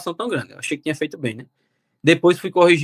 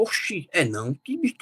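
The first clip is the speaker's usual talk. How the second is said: a startled, incredulous exclamation; stunned disbelief; 6 dB quieter than usual. Retold or imitated. Reading his own exam score in disbelief, shocked exclamation.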